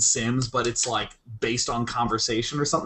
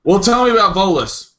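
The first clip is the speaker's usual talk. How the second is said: raised mock conversation voice; louder real-talk version; 8 semitones higher, 9 dB louder than usual. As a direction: say it like a real person would. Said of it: "I'm like".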